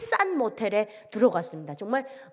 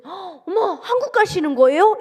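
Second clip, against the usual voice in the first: high, rising-excitement question, getting louder; amazed; 7 semitones higher, 6 dB louder than usual. Retold or imitated. Server asks defector about heading south, amazed and high. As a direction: imitate an astonished gasp of realization.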